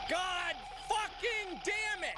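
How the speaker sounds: real furious screaming. Real, not acted. Gamer raging at himself for forgetting again, furious screaming. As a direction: scream your fury at the game.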